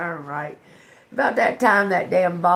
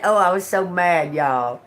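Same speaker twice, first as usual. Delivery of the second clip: ordinary delivery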